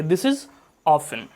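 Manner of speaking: often with a silent t, like "offen"; British often. Shown as a demonstration. Teacher confirming the British often for learners. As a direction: drop the t in often. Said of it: "सही माना जाता है".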